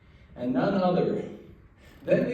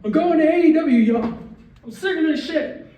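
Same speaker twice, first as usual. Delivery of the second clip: high, loud mock outburst; hammed-up tantrum at the booking; mock rage quit; 12 semitones higher, 4 dB louder than usual. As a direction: storm off in pretend fury. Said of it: "Sorry for storming out again".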